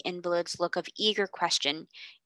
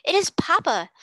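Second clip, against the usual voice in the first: excited; 7 semitones higher, 4 dB louder than usual. Acted. Children see father arrive.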